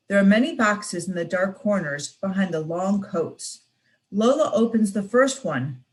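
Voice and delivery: ordinary delivery, nothing marked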